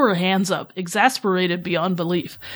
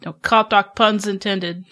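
usual speaking voice

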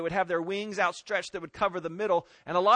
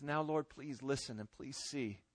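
low, hushed prayer; quiet, pleading petition; humble plea; 4 semitones lower, 11 dB quieter than usual. Acted. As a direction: plead softly and low with God. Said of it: "desperate cry from somebody who knows".

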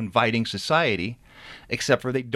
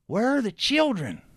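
much higher, breathless and alarmed; breathless sister; 13 semitones higher than usual. Acted. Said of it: "She was breathless".

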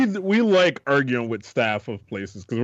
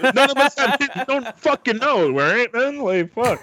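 mock exasperation; 10 semitones higher than usual. Arguing about toddler ages, mock exasperated outburst. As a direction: throw up your hands in fake frustration.

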